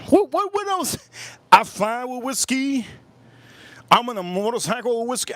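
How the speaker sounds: high, rising excited boast; building hype, voice climbing. Real, not acted. Escalating whiskey boast.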